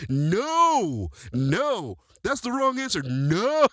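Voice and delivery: high, loud mocking teacher's "no"; funny teacher voice. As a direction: mimic a teacher's comical high-pitched outburst. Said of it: "he had the funniest voice on earth".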